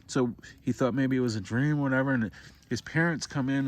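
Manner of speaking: ordinary talk, nothing marked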